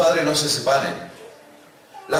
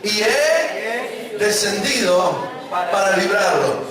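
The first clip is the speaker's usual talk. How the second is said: "Y he descendido para librarlo" read very slowly with the congregation; slow unison reading; 2 semitones higher, much slower than usual. Shown as a demonstration. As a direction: lead everyone word by word. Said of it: "Vamos juntos". (lectura lenta y pausada en conjunto)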